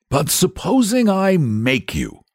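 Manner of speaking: high-pitched, mildly nettled challenge; rather nettled. A narrator's reading. Saint George tests the stubborn dragon, rather nettled. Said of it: "rather nettled".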